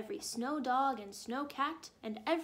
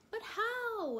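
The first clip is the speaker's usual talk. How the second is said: crowd asking together; 10 semitones higher than usual. A narrator's reading. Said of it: "everyone asked in perfect unison".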